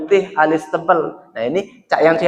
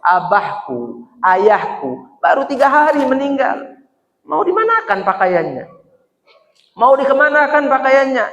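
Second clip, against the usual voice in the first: tearful daughter; 10 semitones higher than usual. Acted. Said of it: "lah nangis pula".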